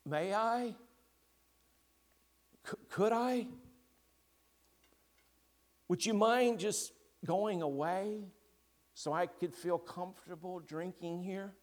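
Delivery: put-on frightened girl asking the lion to go away; timid girl Jill. A narrator's reading. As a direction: ask nervously, hoping he'll leave.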